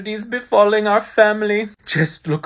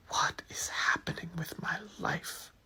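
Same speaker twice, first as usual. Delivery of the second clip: whisper; 15 dB quieter than usual. Acted. Despairing aside.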